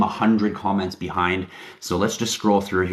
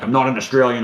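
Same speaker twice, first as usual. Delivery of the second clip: a higher, louder touch of a huffy Kiwi; lightly voiced Kiwi correcting someone; mock indignant New Zealander; 5 semitones higher, 5 dB louder, faster than usual. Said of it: "what the difference is between a New Zealander".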